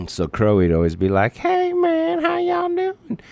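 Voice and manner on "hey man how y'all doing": very high, loud country yokel; cartoon country character, pitched way up; Scarecrow. Shown as a demonstration. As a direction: greet in a high hillbilly falsetto. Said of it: "He's a little bit country".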